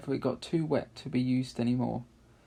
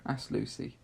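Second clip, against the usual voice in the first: usual speaking voice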